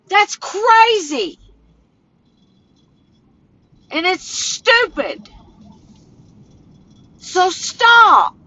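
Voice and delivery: rising to a tearful, shouting outburst; emotional. Real, not acted. Scene: upset woman rants at her online critics, tearful and shouting. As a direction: voice climbs high and cracks into a yell. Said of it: "get emotional".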